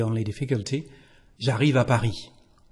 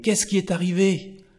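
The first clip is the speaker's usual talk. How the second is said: "Qu'est-ce qui est arrivé?" asked higher, alarmed; surprised; 6 semitones higher than usual. Shown as a demonstration. Teacher acting someone alarmed by bad news, asking higher. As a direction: ask what happened as if shocked. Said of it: "Oh là là".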